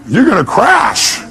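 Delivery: ordinary talk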